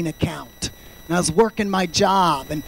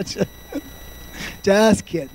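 ordinary delivery

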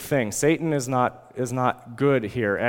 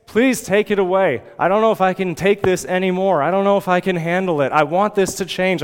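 higher, louder begging prayer; earnest pleading; 5 semitones higher, 4 dB louder than usual. Acted. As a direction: beg desperately for relief, pressing each plea. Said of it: "this earnestly pleading".

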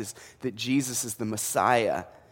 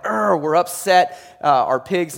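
loud, higher grumbling complaint with a groan; mock upset farmers; 4 semitones higher, 8 dB louder than usual. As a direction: ham up the farmers' grumbling over lost pigs. Preacher voices angry pig farmers.

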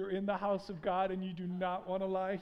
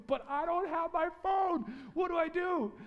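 mock panic; 9 semitones higher than usual. Panicked self-quote, phone gone.

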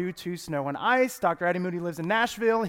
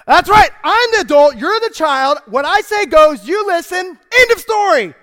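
much higher and louder, slowing on the last words; laying down the law; 9 semitones higher, 11 dB louder than usual. Retold or imitated. Mother to son asserting authority, loud and emphatic. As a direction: lay down the law like a fed-up mother.

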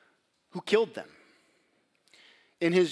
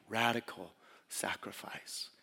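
hushed refrain; 8 semitones lower, 11 dB quieter, slower than usual. Acted. Refrain repeated, much quieter.

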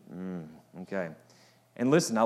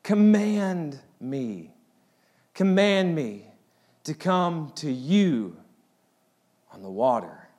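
slow pleading; 4 semitones higher, much slower than usual. Retold or imitated. Peter pleading, drawn out.

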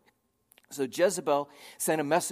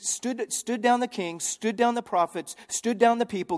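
preaching; 9 semitones higher, 5 dB louder than usual. Real, not acted. Building sermon emphasis.

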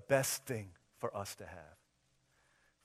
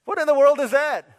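high, loud incredulous cry; loud disbelief; 19 dB louder than usual. Retold or imitated. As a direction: explode in comic disbelief. Incredulous reaction to daughter.

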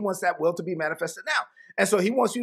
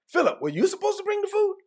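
much higher accusing question; arguing disciple; 13 semitones higher than usual. Retold or imitated. Disciple turns on Philip about the food, high and accusing. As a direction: pin the blame on Philip, pitched way up. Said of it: "It's like".

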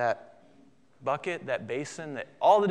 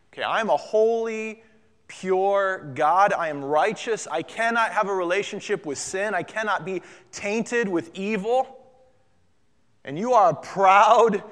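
raised, emphatic declaration of holiness; harsh Jesus; 3 semitones higher, 5 dB louder than usual. Acted. Jesus rebuking Peter as holy God, stern and forceful. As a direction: hammer key words, building to a loud rebuke. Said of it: "Jesus gives a pretty harsh reply".

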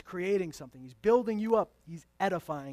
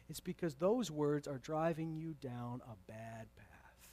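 whisper; 4 semitones lower, 8 dB quieter than usual. Real, not acted.

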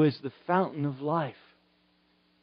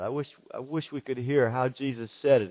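ordinary talk, nothing marked

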